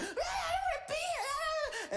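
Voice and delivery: mock high-pitched kiddie wail; wailing kids. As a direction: whine and bawl like bratty kids, way up high. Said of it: "kids are just going".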